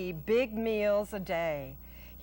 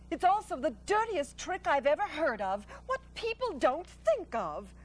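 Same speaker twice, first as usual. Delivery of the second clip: thoughtful, indignant spider; an honest spider grows indignant; Charlotte the spider; 7 semitones higher than usual. Acted.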